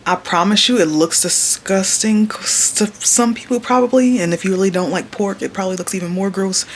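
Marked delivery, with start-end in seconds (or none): none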